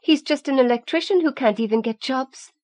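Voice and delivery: Mary sneering at Rowan's father; smirking. A narrator's reading. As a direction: keep the smirk, twist the knife. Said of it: "Mary smirked".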